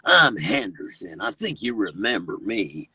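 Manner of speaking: low, slow character voice; Henderson. Acted. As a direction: drop deep and take your time. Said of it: "But he talks like this".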